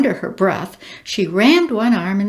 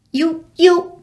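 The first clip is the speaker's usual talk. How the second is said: stammering; 8 semitones higher, 6 dB louder than usual. A narrator's reading. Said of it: "she stammered".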